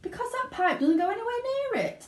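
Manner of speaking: high, fast Wonka voice; quick Wonka. A narrator's reading. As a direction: rattle it off.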